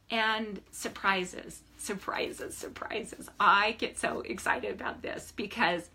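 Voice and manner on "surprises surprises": fading whisper. Acted. Coach savoring universal surprises, whispering them away. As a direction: echo the word softer until it's only breath.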